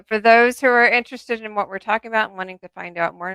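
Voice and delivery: ordinary talk, nothing marked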